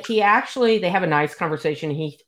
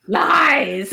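high, hammed-up cry of Lies; dramatic villain line; 10 semitones higher, 4 dB louder than usual. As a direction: throw out Lies like a melodramatic king. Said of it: "which is your favorite line".

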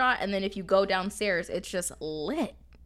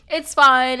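imitating him, loud and higher; loud retold reassurance; 5 semitones higher, 13 dB louder than usual. Drunk guy brushing off the slammed drink, loud imitation. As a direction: burst out with it as the tipsy guy.

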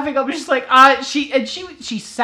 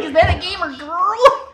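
eager gamer guy; 9 semitones higher, 4 dB louder than usual. Retold or imitated. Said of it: "He's like".

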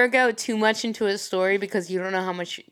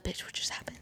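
whisper; 18 dB quieter than usual. Retold or imitated. Texting friend after covering lie.

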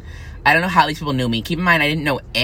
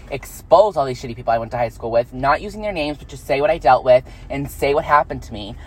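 usual speaking voice

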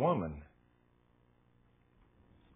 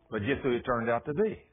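ordinary talk

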